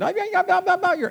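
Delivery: high-pitched delighted chorus of yeahs; thrilled high cries of approval; excited exclaiming. Retold or imitated. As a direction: squeal it like amazed guards. Guards marveling at Visine.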